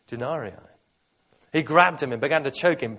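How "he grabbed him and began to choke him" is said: 1 forceful build. A narrator's reading. Narrating violent choking scene.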